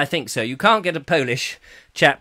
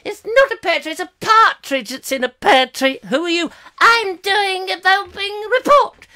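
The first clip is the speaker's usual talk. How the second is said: high, hammed-up silly voices bickering; squabbling reporters; 8 semitones higher, 3 dB louder than usual. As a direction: build to a high, loud mock announcement. Acted reporters squabbling over a tree.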